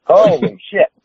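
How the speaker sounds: high exclamation with a laugh; retold shock. Rookie wrestler seeing the razor blade, in retold shock. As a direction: blurt it out, half laughing.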